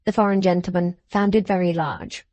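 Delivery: ordinary delivery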